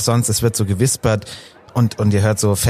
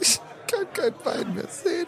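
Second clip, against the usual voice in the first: high weepy voice; 9 dB quieter than usual. Acted. Wife NPC weeping.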